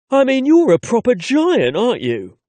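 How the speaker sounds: put-on a cheeky London accent, rising high and loud on "proper giant". The son teases his tall father, cheeky London accent. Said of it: "evident in his London accent".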